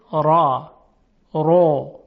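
heavy, thick ر in «را، رو»; full dark r on «را» and «رو»; تفخیم. Shown as a demonstration. («را، رو» با رای درشت)

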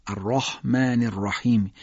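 slow "الرحمن الرحیم" with thick, dark r; deliberate tafkhim recitation; heavy ra. Shown as a demonstration. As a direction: recite "الرحمن الرحیم" with full-mouthed "ra" and long vowels.

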